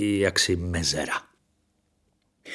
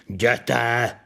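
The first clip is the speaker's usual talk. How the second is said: higher, contained angry shout; furious yell; 4 semitones higher, 4 dB louder than usual. A narrator's reading. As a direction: throw him out with a sharp yell. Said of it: "zařval jsem bez sebe". (rozzlobený, trochu tlumený výkřik)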